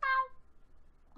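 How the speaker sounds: high drawn-out cat meow; mock meow. Player meowing at the cat, high and drawn out.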